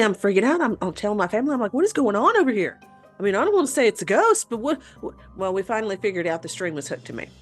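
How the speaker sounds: ordinary talk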